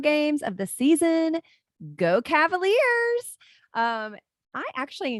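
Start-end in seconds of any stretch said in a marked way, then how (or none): 1.9-3.4 loud, high team cheer. Real, not acted. Mom hyped for the first game, cheering Go Cavaliers. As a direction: shout it like a fan in the stands.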